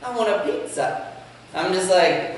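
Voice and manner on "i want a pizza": put-on high, pleading child's voice on Daddy; mock kid asking dad for pizza; pleading little son. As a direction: beg like a small boy with puppy dog eyes. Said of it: "my son comes to me with those puppy dog eyes and says".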